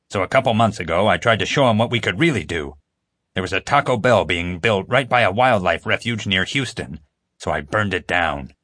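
plain reading voice

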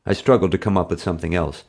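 ordinary delivery

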